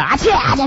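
spoken mock achoo, twice; imitated sneezing. Passenger sneezing behind driving husband, imitated achoos. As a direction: fake a pair of quick sneezes. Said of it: "啊切啊切打喷嚏啊".

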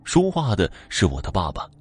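usual speaking voice

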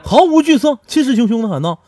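high, loud, laughing brag; boastful narration. Acted. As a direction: puff up fearless.

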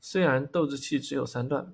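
usual speaking voice

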